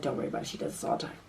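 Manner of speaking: soft unvoiced whisper; whispered reply. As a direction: whisper it low in the dark. Whispering back in dark room.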